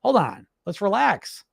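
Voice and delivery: slow, soothing inner coach; a calm coach settling a panicked mind. Retold or imitated. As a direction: ease yourself down gently. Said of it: "this one comes right and says".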